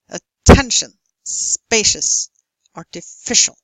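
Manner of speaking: atTENtion, SPAcious, artiFIcial, stressed syllable punched loud; word list with strong stress before each ending. Shown as a demonstration. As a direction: hit TEN, SPA and FI hard. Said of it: "you stress the syllable before this".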